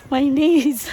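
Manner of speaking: normal talking voice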